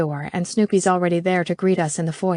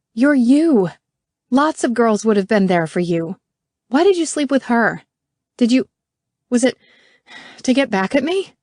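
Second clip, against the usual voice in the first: higher, louder demanding; hurt anger; 5 semitones higher, 5 dB louder than usual. Acted. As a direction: press him for the truth. Hurt angry questioning.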